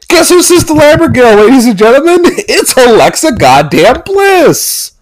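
high-pitched hammed-up announcement; mock big reveal. Reviewer unveiling Sister Abigail to listeners, mock excitement. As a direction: announce it like a ridiculous grand unveiling.

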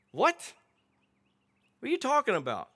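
high, startled what; mock-shocked disciples asking what he means; incredulous disbelief. Mocking the disciples' shocked reaction.

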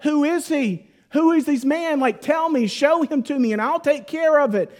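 imitating an enraged king, pitched well up; indignant, high-pitched royal outrage, acted; livid king. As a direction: play the king demanding a culprit. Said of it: "And he is livid".

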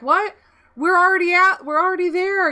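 high, mock-innocent protest; trolling back. Lying to teammates to troll them, mock protesting. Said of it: "I would troll 'em back".

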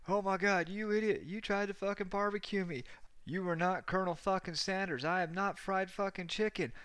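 high, strained mock rant; hammed-up high-pitched tirade; imitated furious screaming. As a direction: rant like an outraged burn victim's friend. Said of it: "he's screaming at him".

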